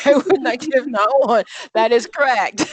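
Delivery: amused answer breaking into laughter; laughing. Real, not acted. Teacher laughing as she confirms the student's answer.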